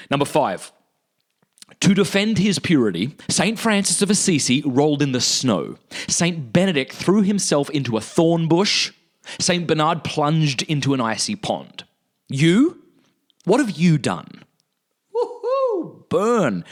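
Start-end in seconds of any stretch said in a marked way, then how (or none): 1.8-14.4 higher, deliberate declaimed reading; quotation read aloud. Acted. Reads out a quotation. 15.2-16.6 high falsetto whoo, then burn; gleeful whoop. Real, not acted. Reacts to a stinging quote.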